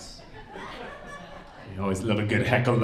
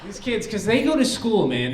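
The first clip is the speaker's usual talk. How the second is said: normal talking voice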